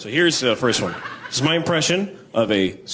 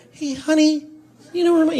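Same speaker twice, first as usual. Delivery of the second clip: much higher, reedy call; annoying reedy voice. Retold or imitated. Partner after three months calling honey, high reedy voice. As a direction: go thin and pinched like a nagging partner. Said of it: "you get that annoying, reedy thing".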